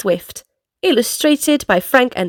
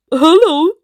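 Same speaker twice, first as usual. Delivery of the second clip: monkey greeting; 4 semitones higher, 4 dB louder than usual. Acted. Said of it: "she says".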